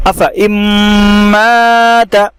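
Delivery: अफ़ इम्माता recited slowly, high, without the alif; Quranic recitation. Shown as a demonstration. Teacher showing students how to read it, slow recitation. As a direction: chant अफ़ इम्माता in drawn-out tajweed. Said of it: "तो इसको पढ़ना है आपने".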